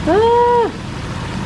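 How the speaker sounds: sudden high yelp at the steam; a startled high cry; surprised yelp. Real, not acted. It's all steaming up.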